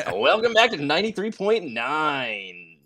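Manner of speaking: high, loud hammed-up DJ intro; drawn-out mock rock-station announcer; radio DJ voice. Shown as a demonstration. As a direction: put on a big radio station voice. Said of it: "I try and do a radio voice".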